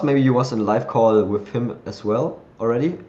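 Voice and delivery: usual speaking voice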